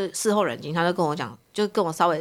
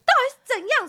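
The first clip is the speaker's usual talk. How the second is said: 拔高嗓门质问; 吵架时冲他吼出来; angry outburst; 3 dB louder than usual. Retold or imitated. Retold quarrel with husband. (跟老公吵架时愤怒地大声质问)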